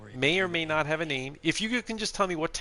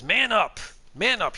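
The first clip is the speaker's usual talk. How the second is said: higher, louder egging-on quote; mock taunt; 7 semitones higher, 5 dB louder than usual. Streamer reading out a chatter's "man up", mock taunting. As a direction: read the taunt out with a raised, punchy voice.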